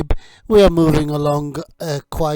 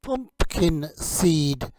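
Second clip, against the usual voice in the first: slow, soft "Pumpkin seed."; slow careful word preview; 4 dB quieter, slower than usual. Shown as a demonstration. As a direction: say "Pumpkin seed." slowly and quietly. Word read slowly.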